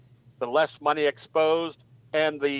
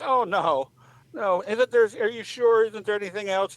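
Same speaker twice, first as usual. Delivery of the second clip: lightly voiced lady, pitched higher; disbelieving parakeet owner; 7 semitones higher than usual. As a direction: plead for another answer in a higher voice. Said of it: "She goes".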